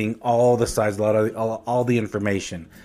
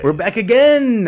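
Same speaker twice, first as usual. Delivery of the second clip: excited; 10 semitones higher, 7 dB louder than usual. Acted. Show intro greeting.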